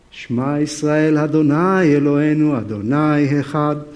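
a lightly voiced solemn Hebrew prayer; serious Hebrew prayer. Peter praying on the roof, lightly voiced solemn Hebrew prayer. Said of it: "here is Peter, very serious, praying. Of course, in Hebrew".

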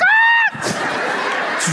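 very high comic shriek; sudden hammed-up falsetto scream; mock scream. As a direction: scream as the floor drops away. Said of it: "I was like".

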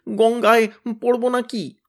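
high, frightened whimper. Acted. Tenida asks fearfully if he'll fall in the river, whimpering. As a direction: yelp the question in fear. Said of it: "Tenida কেউ কেউ করে বললে".

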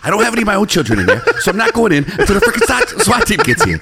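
mock whiny cop. Parodying Uvalde cops making excuses, high laughing whine. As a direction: plead helplessness like a lazy cop.

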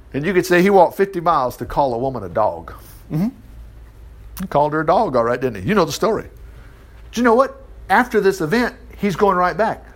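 ordinary delivery

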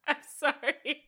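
helpless laugh spilling into the words; laughing apology; cracking up. Real, not acted. Cracking up at a joke.

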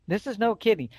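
ordinary talk, nothing marked

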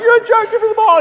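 high-pitched mock heckle; imitated crowd yelling. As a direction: yell like an outraged fan in the stands. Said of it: "people were, like, yelling at him".

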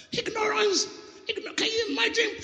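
Ignorance said very high and squealing. Shown as a demonstration. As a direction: pitch Ignorance way up in mock disbelief. Repeats word, second much higher.

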